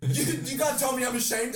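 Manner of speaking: retold furious outburst, loud and high; furious shout. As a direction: scream it like you're squaring up to fight. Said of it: "He was just like".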